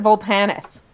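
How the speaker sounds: Voltanis, with the T before the N; personal pronunciation. Shown as a demonstration. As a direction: say Voltanis, T in the middle and no second L. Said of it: "I always pronounce it".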